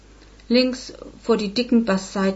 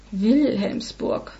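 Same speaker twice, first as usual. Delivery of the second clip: gedehnt, unheilvoll; much slower than usual. A narrator's reading. Mutter denkt an verrufenen Stadtteil.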